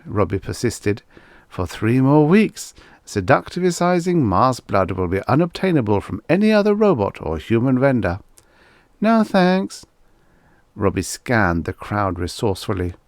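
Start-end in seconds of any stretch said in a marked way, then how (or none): 1.5-8.2 higher, louder pushy sales patter; robot salesman pitch. Acted. 9.0-9.8 high, light lady's voice brushing him off; bored young woman. Acted. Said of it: "the young woman yawned".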